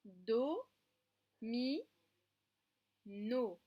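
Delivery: do, mi, no, each beat on its own; syllabes coupées. Shown as a demonstration. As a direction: cut the word into syllables. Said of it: "on coupe les syllabes". (on coupe les syllabes)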